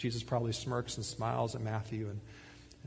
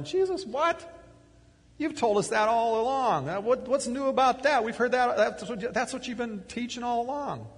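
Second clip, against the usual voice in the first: high, loud, laughing disbelief; incredulous disciples; 11 semitones higher, 6 dB louder than usual. Acted. Disciples to Jesus, baffled and laughing. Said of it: "And the disciples are going".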